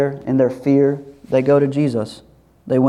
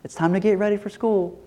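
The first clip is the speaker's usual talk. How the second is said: higher, soft sing-to-wake coaxing; gentle wake-up; 6 semitones higher, 5 dB quieter than usual. Acted. Parent getting a child up for school, gentle wake-up. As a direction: coax a child softly out of sleep.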